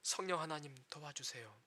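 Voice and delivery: soft, low plea for help; quiet prayer. Shown as a demonstration. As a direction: pray it quietly, like a plea under the breath. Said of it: "뭐라 해야 됩니까". (낮고 조용하게 간절히 드리는 기도)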